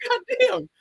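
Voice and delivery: high-pitched mock outrage at the view count; disbelieving outburst. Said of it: "I'm like".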